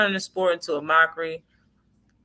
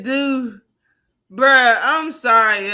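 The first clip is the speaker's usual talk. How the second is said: excited retelling; 5 semitones higher, 7 dB louder, slower than usual. Retold or imitated. Retelling friend's reply, still laughing.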